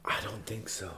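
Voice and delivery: hushed whispered reaction; whispering. Real, not acted. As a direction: whisper it as an aside.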